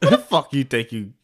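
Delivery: usual speaking voice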